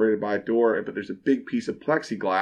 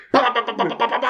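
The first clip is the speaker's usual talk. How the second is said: rapid high "ba ba ba" shots; imitated gunfire; 11 semitones higher, faster than usual. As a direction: fire off quick mouth gunshots.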